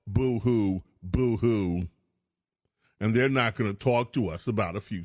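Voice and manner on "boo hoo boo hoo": mocking boo-hoo whine; sarcastic pretend sobbing; fake crying. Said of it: "And the commies tell us".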